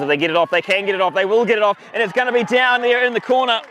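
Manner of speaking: commentator swept up as the try goes in; all excited. Real, not acted. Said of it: "I got all excited".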